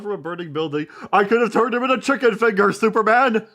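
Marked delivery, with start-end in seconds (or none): 1.1-3.5 high, very loud outraged villain yell; hammed-up supervillain tirade; Lex Luthor. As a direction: shout it like a thwarted cartoon villain. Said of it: "Lex Luthor, after Superman saves a child".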